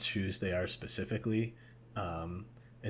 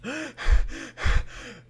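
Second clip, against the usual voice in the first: gasping laugh; 9 dB louder than usual. Retold or imitated. Mocking breathless actor.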